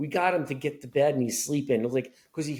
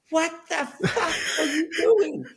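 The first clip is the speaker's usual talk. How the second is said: much higher, shrieking mock rage of a brother jolted awake; imitating startled yell. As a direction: yell it high and cracking, as if woken in a panic. Brother wakes, yells at sister.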